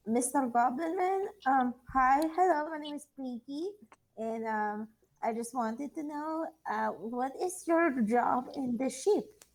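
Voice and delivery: a bit of a cute, eager character voice; sweet Pinky voice. Acted. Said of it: "Perry's like".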